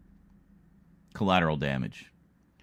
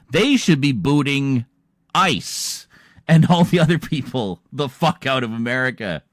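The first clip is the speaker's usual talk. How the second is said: angry rant; 6 semitones higher, 9 dB louder than usual. Real, not acted. Ranting about deporting natives.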